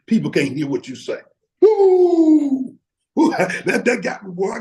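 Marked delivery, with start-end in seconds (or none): 1.6-2.8 long, high, loud "woo"; excited whoop. Real, not acted. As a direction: let out a big falling whoop. Exclaiming at own point.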